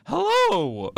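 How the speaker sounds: high, hammy royal greeting; booming king voice. Pretend. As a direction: call out like a jolly NPC king. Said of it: "he should talk like".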